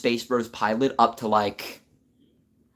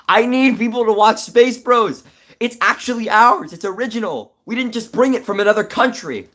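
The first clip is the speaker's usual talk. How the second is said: excited pleading; 11 semitones higher, 9 dB louder than usual. Real, not acted. Pleading for viewers to watch show.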